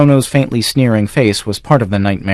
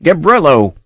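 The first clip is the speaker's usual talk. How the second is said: calling out; 6 semitones higher, 3 dB louder than usual. A narrator's reading. Valenzo calls the servant.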